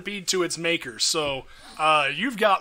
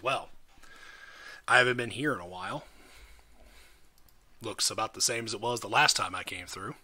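lower, subdued in-character musing; understated warrior character; 6 semitones lower, 7 dB quieter than usual. Acted. Jaya looking over the town, understated low musing. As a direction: speak low and soft, as Jaya surveying the place.

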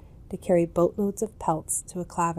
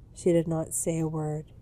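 plain reading voice

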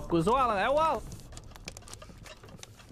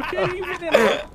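laughing through the question; 3 semitones higher, 4 dB louder, slower than usual. Real, not acted. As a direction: laugh it out incredulously. Laughing at poison accusation.

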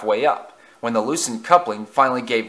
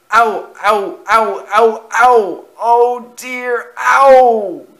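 high, loud yelps of pain; repeated hurt cries building to a big one; pained groaning; 11 semitones higher, 9 dB louder, much slower than usual. A narrator's reading. Engine jerked backwards in the crash, pained yelps. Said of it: "he groaned".

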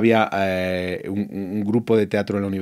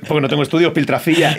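self-mocking; 7 semitones higher, 5 dB louder than usual. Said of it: "Y digo".

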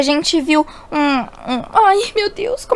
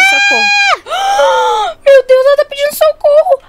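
gasp, then high loud alarm; panicked; 13 semitones higher, 4 dB louder, slower than usual. Acted. Girl realizing her sister is calling for help, panicked. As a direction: cry out in sudden panic.

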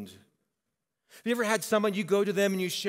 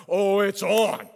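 loud, cocky "it's on"; ready-to-rumble boast; fired-up challenge; 3 semitones higher, 8 dB louder, slower than usual. Retold or imitated. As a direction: puff up for a fight. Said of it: "I'm like".

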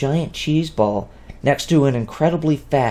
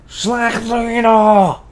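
slow, higher, slurring fat fairy; slurred demand; 11 semitones higher, much slower than usual. Acted. The lazy male fairy slurs an order at his wife. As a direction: drag the words out lazily and slurred. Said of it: "as he slurred".

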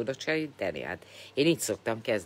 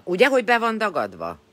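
excited exclaim; 10 semitones higher, 9 dB louder than usual. Real, not acted. Showing her swollen face to viewers.

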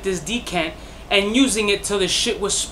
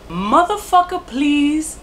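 "Motherfucker" jumps up high, "please" stays raised; outraged disbelief; 6 semitones higher, slower than usual. Real, not acted. Reviewer scoffs at the three-to-five-hundred price, high and incredulous.

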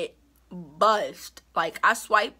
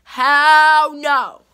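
much louder, drawn-out shouted refusal; loud hell no; 3 semitones higher, 13 dB louder than usual. Real, not acted. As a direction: belt it out as the final word. Rejecting fake friendship, repeated louder.

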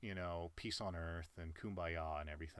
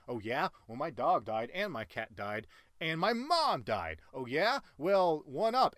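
louder and higher with each boast; escalating mock one-upping; 8 semitones higher, 7 dB louder than usual. One-upper topping every loss, shouting higher and higher. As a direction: pile it on until it is absurd. Escalating contest of who suffers most.

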